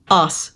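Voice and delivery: "arse" as "ahss", long vowel. Shown as a demonstration. Brit shows co-host "arse" with a silent R. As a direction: leave the R out of "arse". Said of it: "you don't pronounce the R".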